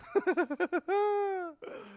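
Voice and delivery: high, wheezy run of laughter; helpless cackle; real laughing fit. Real, not acted. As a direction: crack up at your own joke. Cracking up at own joke.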